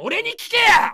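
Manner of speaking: high, loud indignant yell; outraged shout. Real, not acted. Streamer yelling that viewers should ask him, not the reporter. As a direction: demand it at the top of your voice.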